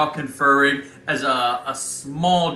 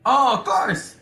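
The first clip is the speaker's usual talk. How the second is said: put-on Italian accent; 11 semitones higher, 4 dB louder, slower than usual. GM as Bobo.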